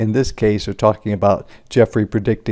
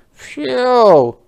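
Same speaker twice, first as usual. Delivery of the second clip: high, loud relieved phew; Jeffrey sighing with relief; tired phew sigh; 13 semitones higher, 6 dB louder than usual. Acted. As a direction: blow it out like you're worn out. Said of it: "sighed Jeffrey".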